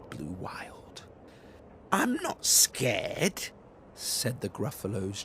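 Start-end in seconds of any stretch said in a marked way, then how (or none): 1.9-3.5 slow, high, defiant little voice; a kid insisting she's brave; brave child. Acted. Gruffalo's child insisting she isn't scared.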